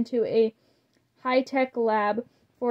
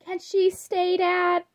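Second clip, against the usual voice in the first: higher pleading daughter voice; begging; 8 semitones higher, slower than usual. A narrator's reading. Daughter begging her father to let her friend stay, high pleading. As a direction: plead sweetly with Dad. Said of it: "begged Draculaura".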